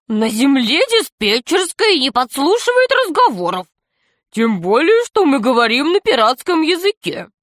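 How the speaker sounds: high-pitched cartoon pirate voice; comic pirate. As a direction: argue carelessly in a high comic voice. Весельчак У спорит с Крысом. (высоким комическим голосом пирата)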